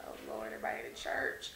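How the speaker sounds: hushed oh-no under the breath; dismayed whisper. Retold or imitated. Actress dreading the church crowd, quick dismayed whisper. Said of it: "I was like".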